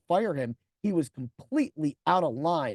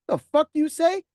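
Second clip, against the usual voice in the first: high, loud outraged question; angry challenge; 9 semitones higher, 6 dB louder than usual. Retold or imitated. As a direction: bark it at the driver. Manager confronts rude driver.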